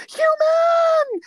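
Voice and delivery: high, loud cartoon shriek; mock freak-out. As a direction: drag out one panicked, hammed-up scream. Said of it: "And then freaks out".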